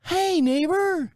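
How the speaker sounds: high, slow singsong hey neighbor; neighbor's greeting. Retold or imitated. Neighbor lady calling sweetly across the driveway, high singsong. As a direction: sing it out, sugary and drawn. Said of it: "does one of these".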